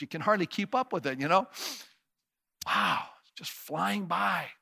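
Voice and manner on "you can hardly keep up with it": usual speaking voice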